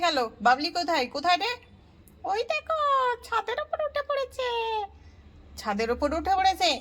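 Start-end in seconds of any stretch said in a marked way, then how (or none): 2.3-4.5 put-on high child pointing and telling; a little kid tattling.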